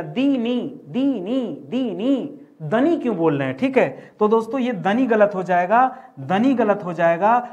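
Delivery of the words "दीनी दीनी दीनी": दीनी repeated slowly, stressing दी; drilling the syllable. Shown as a demonstration. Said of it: "दिनी बोलते हैं ना". (दीनी पर ज़ोर देकर दोहराना)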